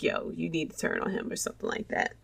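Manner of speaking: hushed whispered thought; conspiratorial whisper. Retold or imitated. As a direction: whisper it like a secret. Voicing his private thought.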